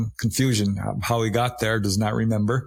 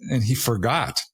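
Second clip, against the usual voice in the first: ordinary talk, nothing marked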